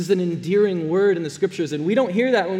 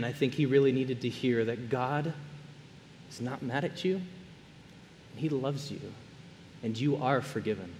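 much softer, slowing right down on "he loves you"; tender emphasis; 6 semitones lower, 9 dB quieter than usual. Real, not acted. Pastor to congregation, softly reassuring. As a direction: let it sink in gently. Pastor stresses God's love.

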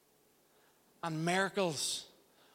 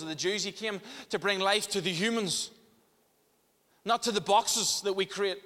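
fervent, louder preaching with higher pitch; preaching at full force; 6 semitones higher, 4 dB louder than usual. Acted. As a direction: preach it out to the whole church.

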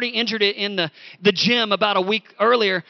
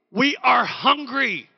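whiny complaint; 4 semitones higher, slower than usual. Acted. Israelites complaining voiced.

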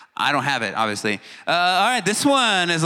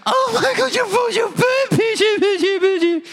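very high, louder squeal of delight at a dog; gushing dog lover; 4 dB louder than usual. Retold or imitated. Dog-loving staff cooing over a dog, high excited squeal. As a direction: squeal like you just saw the cutest puppy. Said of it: "But they're like".

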